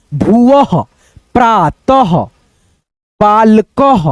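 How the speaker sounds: भुवः, प्रातः, बालकः each ending in an echoed h; careful, separated reading of भुवः, प्रातः, बालकः; half-H ending. Shown as a demonstration. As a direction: sound the ह clearly closing भुवः, प्रातः, बालकः. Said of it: "आधा ह का उच्चारण".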